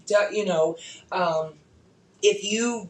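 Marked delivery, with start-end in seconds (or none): none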